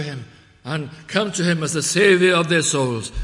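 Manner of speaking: loud, high, rising preacher's cry; full-force preaching. Acted. Preacher urging the congregation to stop and listen, loud preaching. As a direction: thunder it from the pulpit.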